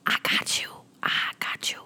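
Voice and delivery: hushed, secret-sharing whisper. Acted. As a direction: lean in close and whisper it. Hushed promise in masterclass ad.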